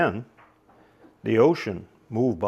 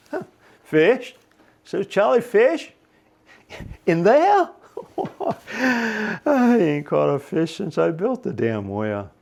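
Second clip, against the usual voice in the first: Charlie scoffing; 8 semitones higher, 3 dB louder than usual. Acted. Charlie scoffs at sea serpent.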